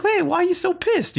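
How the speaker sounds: high, squeaky defensive self-imitation; hammed-up squeaky bewilderment; mock innocent protest. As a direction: plead innocence in a silly high voice. Acting out own reply to dad.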